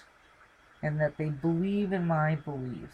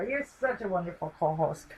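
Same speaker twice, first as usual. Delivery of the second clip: ordinary talk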